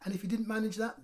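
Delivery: ordinary delivery, nothing marked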